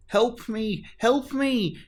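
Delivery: high, frightened calls for help; squeaky alarmed help me; crying for help. Acted. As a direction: panic caught in a thorny bush. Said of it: "Charlie cried".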